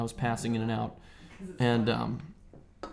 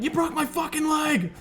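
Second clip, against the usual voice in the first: put-on angry kid yell. Kid shouting at brother who broke his leg, furious. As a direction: yell at your brother with real hurt. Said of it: "I'm like".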